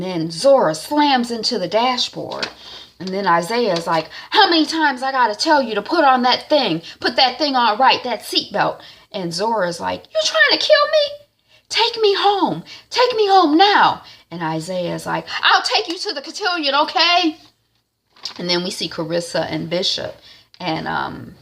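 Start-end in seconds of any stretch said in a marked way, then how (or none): 4.3-8.8 put-on loud, higher scolding boyfriend. Isaiah scolding Zora after slamming brakes, loud. 10.1-14.0 very high, loud shrieking demand; shrieking teen. Retold or imitated. Zora screaming at Isaiah after the crash, shrill shout. 15.4-17.4 much higher, pleading placating voice; placating boyfriend. Retold or imitated. Isaiah giving in to upset Zora, high pleading.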